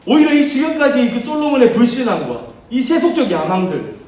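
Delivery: ordinary talk, nothing marked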